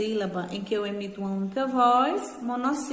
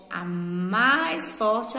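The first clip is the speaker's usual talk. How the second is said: "mais" greatly drawn out in "a mais forte"; stress on MAIS; much slower than usual. Shown as a demonstration. Teacher defining oxytone words, MAIS heavily stressed in "a mais forte". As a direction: stretch MAIS hard, slowly, in "a mais forte". Teacher emphasising the strongest syllable.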